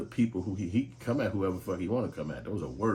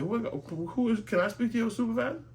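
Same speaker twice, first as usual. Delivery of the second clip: much higher, outraged squeal demanding a supervisor; indignant outrage; 5 dB louder than usual. Retold or imitated. Caller to police, outraged high-pitched demand for a supervisor. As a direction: act scandalized, voice shooting up.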